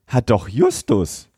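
normal talking voice

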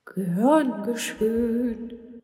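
hooting kind ghost. Acted. Ghost replying to the witch's thanks, high and slow. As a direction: answer warmly like a gentle spirit. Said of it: "schuhute Silberhaar".

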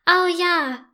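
high, bright eager cry; delighted. A narrator's reading. Girl eagerly accepts the invitation to play. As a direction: jump at the offer to play. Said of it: "ruft Yvonne begeistert".

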